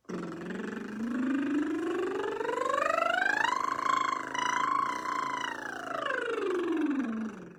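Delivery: long vocal slide up and down like a wave; siren voice glide. Shown as a demonstration. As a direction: sweep the voice high and back down. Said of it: "stretch our voices ... up and down like a big wave".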